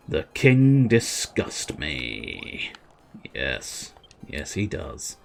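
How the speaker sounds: slow, low, quiet aside; contemptuous schemer. Acted. Plotter privately loathing the king, slow and low. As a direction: draw out the disgust in a low voice.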